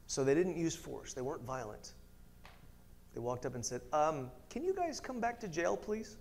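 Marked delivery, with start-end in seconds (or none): 3.9-6.2 soft, hesitant, very polite ask; timid officer politely pleading; meek request. Acted. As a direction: ask sheepishly, as if afraid to impose. Acting officers timidly asking apostles.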